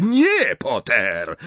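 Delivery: shouted refusal. A narrator's reading. Snape forbidding Potter's spell, escalated shout. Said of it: "krzyknął Snape".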